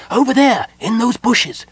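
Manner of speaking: high, loud cry from a small kender; shrieky little lookout spotting the enemy; excited cry. A narrator's reading. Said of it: "Tasselhoff cried".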